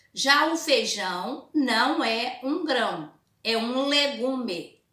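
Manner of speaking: put-on slow, emphatic reading to children; story read-aloud. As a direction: read it out word by word for pupils. Reads comic aloud to pupils.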